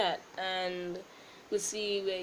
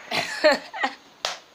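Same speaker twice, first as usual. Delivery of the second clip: high, loud laugh; loud burst of laughter; 9 semitones higher, 11 dB louder than usual. Real, not acted. Fails jump, laughs.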